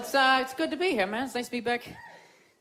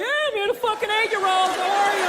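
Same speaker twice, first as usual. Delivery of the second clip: mock cheerful guy; 5 semitones higher, 3 dB louder than usual.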